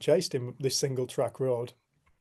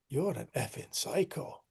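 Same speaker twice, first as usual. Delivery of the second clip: softer, stunned-sounding retort; subdued accusation; 7 dB quieter than usual. Retold or imitated. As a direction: say it quietly, imitating a wary man. Driver's stunned reply after calm.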